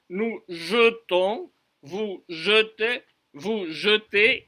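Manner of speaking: slow JETONS and JETEZ with a rounded "zhuh"; unstressed e pronunciation. Shown as a demonstration. Teacher showing the phonetic change, slow careful syllables. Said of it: "Vous avez entendu la, le changement phonétique".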